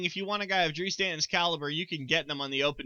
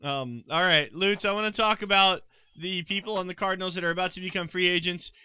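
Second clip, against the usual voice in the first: ordinary delivery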